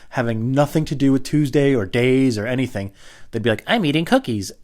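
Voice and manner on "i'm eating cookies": higher, casual little voice; put-on phone voice. Person on the line chats away, higher put-on voice. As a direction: lift the pitch for the other caller.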